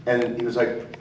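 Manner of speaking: ordinary delivery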